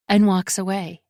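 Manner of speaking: plain reading voice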